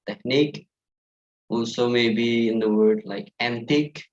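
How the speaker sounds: ordinary talk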